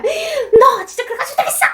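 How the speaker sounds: shrieky high-pitched telling-off; imitated angry mother yelling; yelled scolding. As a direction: scold a child in a very high yell. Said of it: "막 이렇게 막 소리를 지르면서 혼을 내다가".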